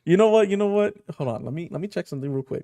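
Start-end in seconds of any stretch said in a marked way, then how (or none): 0.0-0.9 excited outburst. Real, not acted. Sudden idea after emotional chapter.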